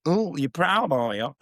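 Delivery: high, swooping mock-impressed question; mock teasing. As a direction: tease someone for showing off. Mock teasing of own pride.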